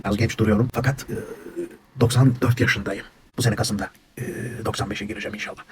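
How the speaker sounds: usual speaking voice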